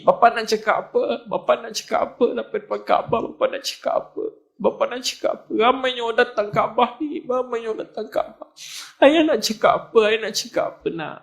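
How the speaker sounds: high, thin-voiced overwhelmed father, repeating himself helplessly; imitated tearful pleading. Old father lost for words before the Kaabah, imitated tearful pleading. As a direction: act out a father pleading through tears, pitched far up. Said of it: "Dia nangis je".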